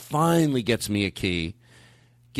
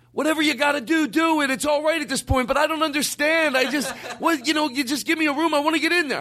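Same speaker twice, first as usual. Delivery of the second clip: retold loss of patience, voice jumping way up; blowing up. Guest finally snapping at hotel clerk, blowing up. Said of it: "I blow up".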